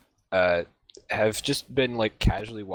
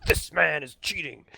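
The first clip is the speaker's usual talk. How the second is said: gruff accusation; 6 semitones higher than usual. Acted. Thug voiced accusing again.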